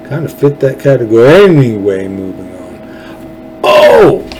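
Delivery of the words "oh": sharp, very high, loud Oh!; excited burst as a thought lands; sudden realization. Real, not acted. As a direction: yelp it as an idea hits. Sudden idea mid-talk.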